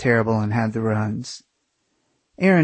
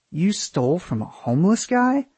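higher-pitched question with a steep final rise; stunned, rising question; incredulous; 7 semitones higher than usual. A narrator's reading. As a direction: ask it in disbelief, swooping up at the end. Friend questions the theft.

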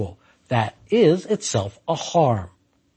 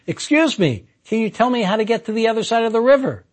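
higher-pitched call across a river; quick mild yell, raised in pitch; calling over; 7 semitones higher, faster than usual. Acted. Hiker to blonde across the river, calling over. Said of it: "yells over".

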